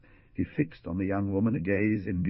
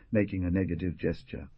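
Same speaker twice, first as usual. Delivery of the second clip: usual speaking voice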